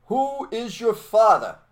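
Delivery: high, loud, slow call of a teacher; teacher's call. Retold or imitated. Soviet teacher quizzing schoolkids, high and loud. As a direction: ring out the question to the class. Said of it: "the kids would yell out".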